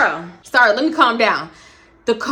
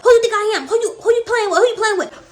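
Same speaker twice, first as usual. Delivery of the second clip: much higher, shrill-edged imitation of her yelling; mock furious yell; 8 semitones higher than usual. Girlfriend confronting Boney, mock furious yell. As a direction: scream it at him like she's squaring up.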